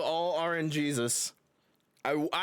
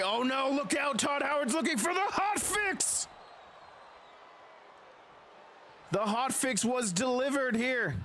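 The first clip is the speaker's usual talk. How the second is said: hyped play-by-play wrestling call; wrestling commentator; 8 semitones higher than usual. Acted. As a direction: call the match like live ringside commentary.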